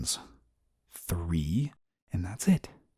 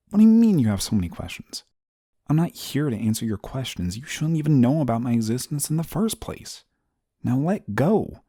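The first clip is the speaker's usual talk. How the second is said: higher-pitched, exasperated objection; indignant protest; 5 semitones higher, 3 dB louder than usual. Acted. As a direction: scold someone who won't let you go. Indignant at being held and questioned.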